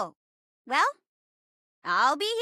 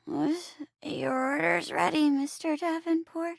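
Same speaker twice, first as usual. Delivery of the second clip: normal talking voice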